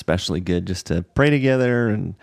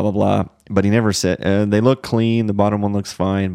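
ordinary talk

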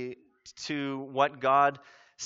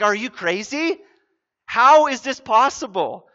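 higher, louder astonished questioning; flabbergasted, building louder; incredulous; 6 semitones higher, 7 dB louder than usual. Acted. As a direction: blurt it out in blunt disbelief. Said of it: "she's saying it in a politer way".

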